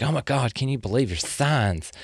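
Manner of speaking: soft, hushed mock-female voice, slowing down; softly put-on voice of an astrology-loving wife; wife impression. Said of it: "spot on, spot on, uh, impression here".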